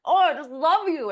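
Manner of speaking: hammed-up gushing at the dog; gushing over dog. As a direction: coo it like greeting a beloved dog. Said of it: "you're like".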